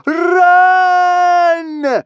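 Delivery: very high, loud scream of warning; frantic shout. A narrator's reading. Kamikaze on deck screams at Hiccup to run. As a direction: scream it across the ice. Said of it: "shouted Kamikaze".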